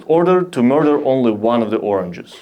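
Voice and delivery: normal talking voice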